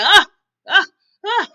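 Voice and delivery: short, loud mock yelps; imitated yelps. He reacts to talk of a blood test, imitated yelps. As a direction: mimic him crying out in sharp bursts. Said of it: "He was like".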